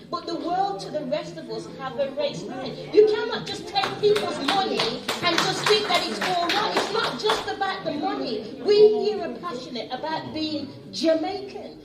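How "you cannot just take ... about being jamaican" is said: raised, higher voice driving the point home; heated public challenge; impassioned protest. Real, not acted. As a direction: press it hard, loud and urgent. Impassioned appeal to official.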